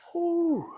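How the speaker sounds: big breathy whew after effort; relieved exhale. Real, not acted. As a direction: blow out the air in relief.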